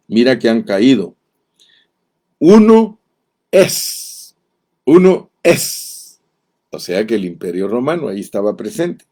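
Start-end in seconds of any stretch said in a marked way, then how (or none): 2.4-6.2 much higher and louder on "Uno", then slow; emphatic proclamation. Acted. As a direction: proclaim it as the key point of the prophecy. Predicador recalca la profecía. (proclamación enfática, aguda y fuerte)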